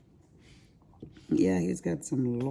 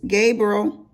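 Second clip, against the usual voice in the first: loud call of a grandson's name; grandma calling Gabriel over; 5 semitones higher, 9 dB louder than usual. Real, not acted. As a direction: call him across the room.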